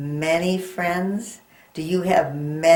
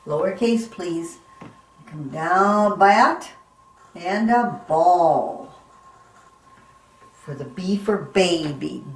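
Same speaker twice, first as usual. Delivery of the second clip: ordinary delivery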